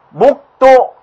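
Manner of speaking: 목도 said high as 목또, only ㄱ sounded; first-consonant pronunciation. Shown as a demonstration. Teacher tests the first-consonant rule, sounding it out. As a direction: sound out 목도 crisply as 목또. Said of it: "첫째 자음대로".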